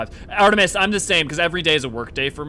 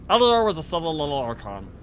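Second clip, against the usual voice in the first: playful put-on character voice; grabby voice; 4 semitones higher, 4 dB louder than usual. Shown as a demonstration. Streamer replaying the silly little Archon line, grabby voice. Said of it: "Should I say it in a grabby voice?".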